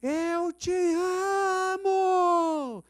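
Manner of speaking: long, drawn-out high shout; shouted declaration. Retold or imitated. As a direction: bellow it down the empty street.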